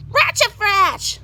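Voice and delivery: high, loud screech; angry old witch shrieking at children; loud witch squawk. A narrator's reading. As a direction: squawk it and storm off. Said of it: "she squawked loudly".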